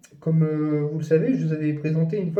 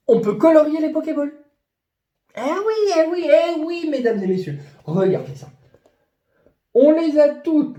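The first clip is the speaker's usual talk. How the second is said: much higher and louder, showman excitement; enthousiasme débordant; 12 semitones higher, 5 dB louder than usual. Real, not acted. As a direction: present it like a thrilled game-show host. Excited showcase of Pokéballs. (voix de bateleur surexcité)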